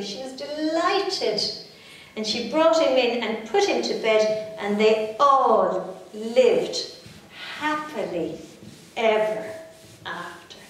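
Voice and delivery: ordinary delivery, nothing marked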